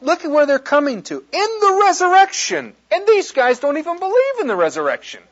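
high, loud, incredulous excitement; worked up. Real, not acted. Preacher to congregation about opponents' contrived question, excited. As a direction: get carried away, pitch climbing high. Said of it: "I get so excited about this".

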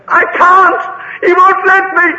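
high, loud yell from a shell hole; shouted reply. Retold or imitated. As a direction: holler it back helplessly. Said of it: "From the shell hole came the words".

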